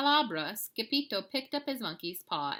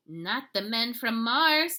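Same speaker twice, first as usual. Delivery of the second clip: slow, louder and dramatic; declared; 5 semitones higher, 9 dB louder, slower than usual. A narrator's reading. Kitty boy declaring to friends, slow and loud. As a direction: declare it with dread, slowly. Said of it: "declared Scapito".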